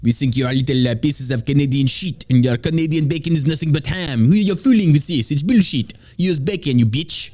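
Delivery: mock French insult tirade; French accent. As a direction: rant like a cartoon Frenchman. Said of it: "I don't know why I did the French thing".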